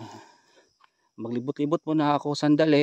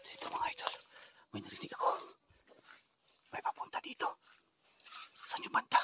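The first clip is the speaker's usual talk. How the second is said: whisper; 8 semitones lower, 11 dB quieter than usual. Real, not acted. Whispering to viewers on hunt.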